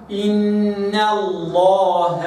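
slow إن الله with the noon kept thin; light noon. Shown as a demonstration. Teacher reciting the noon thin before Allah. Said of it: "وإنما".

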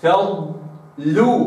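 فضلوا with a slow stretched ض; held dad. Shown as a demonstration. Teacher demonstrating فضلوا, slow held ض. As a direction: lean on the ض in فضلوا. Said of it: "وإنما فضلوا".

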